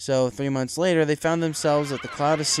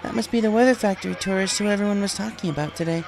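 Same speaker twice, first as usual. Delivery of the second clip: higher, lighter voice for the mother; mother's voice; 8 semitones higher than usual. A narrator's reading. Mother recalls her daughter, in a higher voice. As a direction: voice the mother, wistful and fond. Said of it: "She asked".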